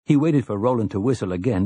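ordinary delivery, nothing marked

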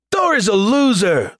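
high, slow wailed taunt; drawn-out, high cry of complaint; bitter mock weeping. A narrator's reading. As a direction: whine it like a sore loser. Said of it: "weeping bitterly".

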